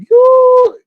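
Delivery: sudden high, loud "You"; shocked outburst. Retold or imitated. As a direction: blurt it out in disbelief. Shocked by the rude clerk.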